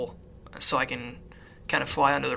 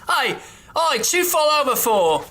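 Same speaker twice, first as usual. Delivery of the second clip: very high, laughing urchin voice; cheeky kid hollering through laughter; plucky orphan voice; 11 semitones higher than usual. Pretend. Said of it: "orphan, like, pickpockets".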